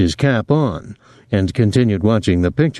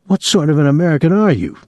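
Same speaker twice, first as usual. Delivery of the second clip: higher, brisk, mock-patriotic challenge; rising patriotic indignation; 7 semitones higher, faster than usual. A narrator's reading. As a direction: ask it with a swell of patriotic outrage. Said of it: "his voice rising patriotically".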